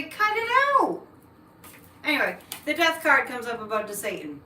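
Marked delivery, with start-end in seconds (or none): 0.0-1.1 mock demand. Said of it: "Write to M S N B C and say".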